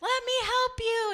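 very high, strained call from a helicopter; shouting rescuers. Acted. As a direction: shout down to someone far below. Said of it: "they're shouting at him".

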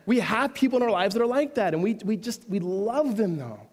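preaching with force. Acted. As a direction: drive the point home. Preacher building to emphasis.

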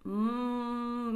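slow thinking hum; drawn-out hmm. Shown as a demonstration. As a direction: stall while thinking. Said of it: "みたいな感じの".

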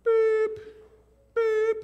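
high, loud electronic beep; piercingly high vocal beep; imitated intercom beep. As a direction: mimic a school PA tone. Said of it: "I hear".

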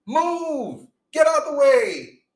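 high, urgent warning shout; screaming move. Pretend. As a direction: yell it to save someone from a car. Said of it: "you scream".

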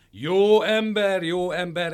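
slow, high, loud mimicry of "Jó ember"; mocking guard. A narrator's reading. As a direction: drag out "Jó ember" to mock him. Guards mock his answer.

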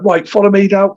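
lightly voiced corporal leading the section; corporal order. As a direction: play the corporal giving an order.

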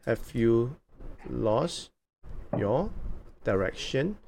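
one word at a time, each lifting at the end; slow dictation. Real, not acted. Host writing down the new question, slowly word by word. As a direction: pause after each word as if noting it down.

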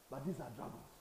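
ordinary talk

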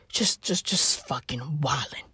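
hushed, breathy whisper. Real, not acted. Comic whispering about Elon wildin', hushed. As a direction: drop to a secret whisper.

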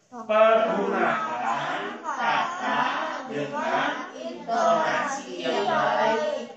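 loud, slow group recitation; reading aloud together. Real, not acted. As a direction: lead the class reading in unison, drawn out. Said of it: "kita baca yuk sama-sama".

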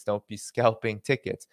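usual speaking voice

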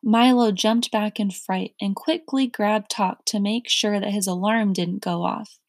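plain reading voice

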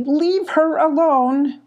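high, drawn-out defiant yell. A narrator's reading. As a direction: yell it slowly, stretching each word high. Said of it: "yelled Molly".

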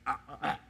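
retelling how he choked up, gasping softly; choked-up stammer. Retold or imitated. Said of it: "I was just like".

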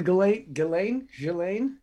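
GHISLAINE as a rising question, testing each version; unsure pronunciation. Shown as a demonstration. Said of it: "I've been saying Ghislaine".

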